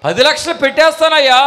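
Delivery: high, loud eager boast; proud loud promise. Acted. Believer boasting to the pastor about spending, loud and eager. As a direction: shout it out proudly.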